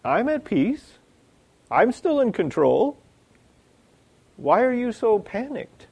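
high-pitched, untroubled bafflement; Jesus. Acted. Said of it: "I wonder if Jesus looks at our lives and said".

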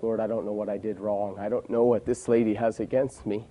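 soft, hushed plea with a hint of tears; quietly recalled tearful prayer; retold weeping prayer. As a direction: murmur it like a remembered cry to God. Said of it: "I was weeping".

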